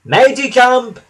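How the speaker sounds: high, loud teasing call; gleeful teasing. A narrator's reading. Thomas teasing James as he speeds off, gleeful call. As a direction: crow it out, pleased with the prank. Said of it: "laughed Thomas".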